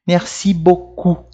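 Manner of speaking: slow French "merci beaucoup" ending on "ou"; measured "merci beaucoup" with throaty R; silent final P. Shown as a demonstration. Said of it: "A gente não fala essas letrinhas".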